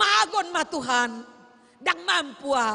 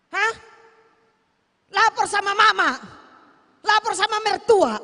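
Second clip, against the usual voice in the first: mock scolding; 5 semitones higher, 5 dB louder than usual. Mock scolding of complaining wife.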